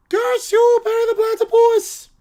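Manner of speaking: high, loud villain howl; cartoon villain curse. Defeated villain cursing Perry, shrieked theatrically. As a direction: shake the rafters with a hammed-up curse.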